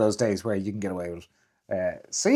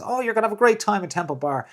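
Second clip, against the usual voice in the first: higher-pitched imitation of a gushing recommender; mock enthusiasm; 10 semitones higher than usual. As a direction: mimic someone overselling it, pitched up. Mimics gushing Temple Bar promoters.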